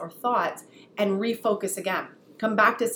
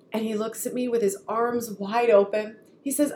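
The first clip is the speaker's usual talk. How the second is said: ordinary talk, nothing marked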